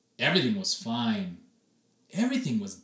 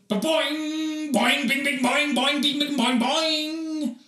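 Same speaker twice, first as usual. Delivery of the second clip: boing sound effects; 12 semitones higher, 5 dB louder, slower than usual. Acted. Springing hair noises.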